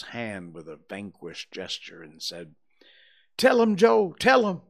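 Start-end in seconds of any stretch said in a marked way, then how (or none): 3.4-4.6 high, loud anguished plea; desperate begging. A narrator's reading. Vanquished Potter to Injun Joe, anguished begging. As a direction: cry it out, giving up.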